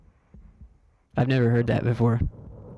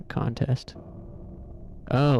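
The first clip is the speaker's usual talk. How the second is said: ordinary talk, nothing marked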